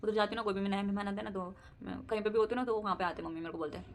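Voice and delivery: ordinary talk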